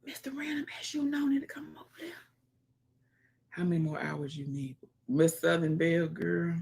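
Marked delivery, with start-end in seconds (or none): 0.0-2.1 quick hushed whisper. Real, not acted. Streamer whispering to a viewer, Mister Random, fast and hushed.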